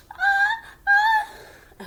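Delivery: building sneeze. Acted. Gerald trying to answer Piggy, about to sneeze. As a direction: hold back a sneeze that keeps coming.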